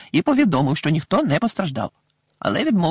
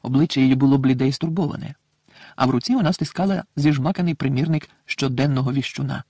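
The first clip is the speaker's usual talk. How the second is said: usual speaking voice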